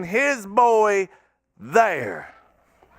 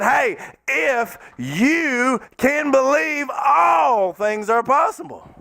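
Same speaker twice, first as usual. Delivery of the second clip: slow, higher, shouted promise; preaching shout; 5 semitones higher, slower than usual. Acted. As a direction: call it out like a proclamation. Jesus voiced, preached loud.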